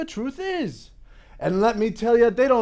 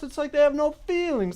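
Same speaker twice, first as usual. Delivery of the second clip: ordinary delivery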